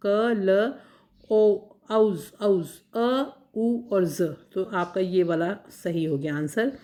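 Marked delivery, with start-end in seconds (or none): 0.1-4.2 क, ल, औज broken into slow separate sounds. Shown as a demonstration. Teacher transcribes a word for students, slowly sounding each piece. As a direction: sound out क ल ओ one piece at a time. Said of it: "क के बाद में सीधा ल आ रहा है".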